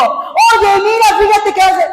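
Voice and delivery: high, forceful demonstration of being serious; insistent, high-pitched call for attention; serious address. Shown as a demonstration. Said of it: "si yo te hablo seria y te digo".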